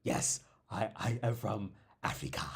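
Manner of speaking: lightly put-on African accent, said to tease; a hint of a jokey African accent; mock African accent. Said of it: "the African accent".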